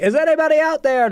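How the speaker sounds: very high, loud call; imitating the searcher. Friend mimics the lifeboat searcher very high and loud. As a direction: shout out over the sea. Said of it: "this person's like".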